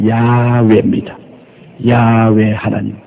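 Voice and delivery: 야훼 slow and low, long YAAA then hwe; Hebrew pronunciation. Shown as a demonstration. Preacher teaching God's original name, slow drawn-out 야훼. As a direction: draw out the first syllable of 야훼. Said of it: "원래 하나님이란 히브리 원어는".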